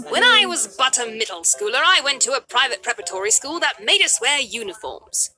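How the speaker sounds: put-on British accent. Recalling private preparatory school in a British accent. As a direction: lapse into a mock British accent. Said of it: "I said that in a British accent".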